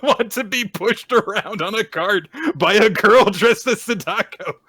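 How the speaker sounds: high, loud, helpless amusement; laughing while talking. Real, not acted. Podcaster picturing the boy carted through crowds, cracking up. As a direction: squeal it through real laughter. Teller cracking up at absurd scene.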